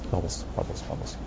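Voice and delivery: unvoiced soft repeat of bubbles; soft murmur. Shown as a demonstration. Teacher murmuring to the meditators, hushed. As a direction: breathe the words out softly.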